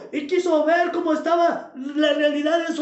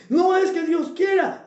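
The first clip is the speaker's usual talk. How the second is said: ordinary delivery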